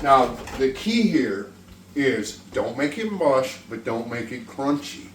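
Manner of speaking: ordinary delivery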